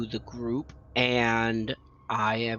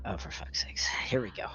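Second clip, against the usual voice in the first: muttered whisper; 3 semitones lower, 7 dB quieter, faster than usual. Real, not acted. Player dreads deception check.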